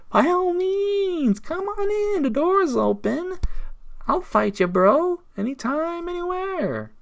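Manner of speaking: high-pitched, drawn-out open invitation; taunting challenge. Real, not acted. Vlogger invites his enemy to come fight, taunting. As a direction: dare them, stretching words up high.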